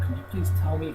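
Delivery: ordinary delivery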